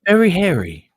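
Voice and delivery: loud deadpan robot answer; AI bot voice. Pretend. Robot friend answers the host's joke question, loud and deadpan. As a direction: answer like a blunt machine. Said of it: "I'm an A I bot".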